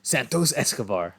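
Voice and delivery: slow mock Spanish-accented name; thick Spanish accent. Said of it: "a thick accent".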